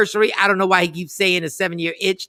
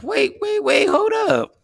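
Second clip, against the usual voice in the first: startled wait; 14 semitones higher, slower than usual. Real, not acted. Laptop battery panic.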